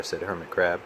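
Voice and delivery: ordinary delivery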